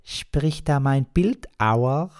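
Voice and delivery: put-on high, slow, loud young voice with a speech defect; a girlfriend answering the phone, high and slow with a speech defect; young girlfriend with a lisp. Girlfriend on the phone to her man, high young voice with a speech impediment. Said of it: "eine junge Stimme mit gewohntem Sprachfehler".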